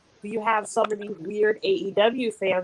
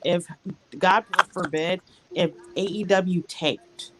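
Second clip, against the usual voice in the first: usual speaking voice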